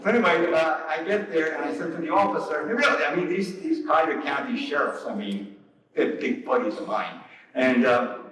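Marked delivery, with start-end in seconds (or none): none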